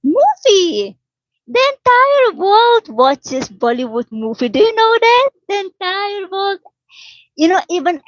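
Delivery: high-pitched, emphatic exclaiming; excited disbelief. Real, not acted. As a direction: exclaim it high, as if everyone should know. Excitedly insists Bollywood is world-famous.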